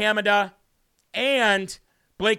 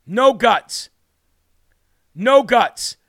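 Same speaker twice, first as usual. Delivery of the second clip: emphatic; 3 semitones higher, 6 dB louder, much slower than usual. Real, not acted. Host berating a concession.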